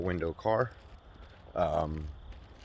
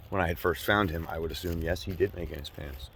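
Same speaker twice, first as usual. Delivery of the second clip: ordinary talk, nothing marked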